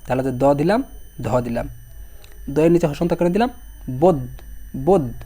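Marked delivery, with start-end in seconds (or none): 3.9-5.1 বোধ cut off on the final consonant; no final vowel. Shown as a demonstration. Teacher showing students why hasanta is written, final consonant left bare. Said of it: "কোনো স্বরধ্বনি আসতেছে না".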